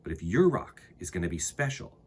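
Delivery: put-on unhurried read-aloud for kids; storybook reading. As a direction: read it like storytime to little ones. Reads a children's book aloud.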